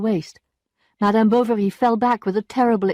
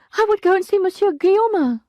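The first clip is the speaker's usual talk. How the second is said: a higher maid's voice, loud rather than sighed; sighing maid; 12 semitones higher, 5 dB louder than usual. A narrator's reading. Maid gently advising her ruined mistress, with a sigh. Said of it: "sighed Félicité at last".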